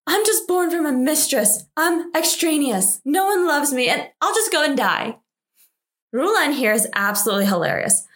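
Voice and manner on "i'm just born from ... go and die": a lightly acted whiny sniveling, pitched higher; mock sniveling. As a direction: imitate a self-pitying sister, hamming up the snivel. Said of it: "She starts sniveling and says".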